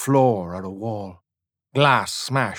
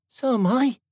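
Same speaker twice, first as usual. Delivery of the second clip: put-on much higher, prim girl voice; indignant girl. A narrator's reading. Girl to the soldier, high and indignant. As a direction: correct him proudly.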